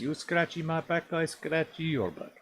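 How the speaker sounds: put-on slow, broken Russian accent; playful Russian accent, slow. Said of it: "doing the Russian accent".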